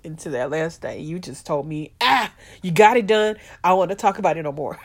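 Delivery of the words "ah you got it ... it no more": loud, fast brush-off; loud dismissal. Retold or imitated. Daughter imitates mom ending the will talk, loud brush-off. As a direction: wave it off loudly and quickly.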